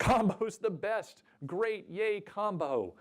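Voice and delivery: high, slow hammed-up fan cheer; mock cheer. Speaker echoing an audience answer as a mock fan, high and slow. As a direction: play the overexcited combo fan, tongue in cheek.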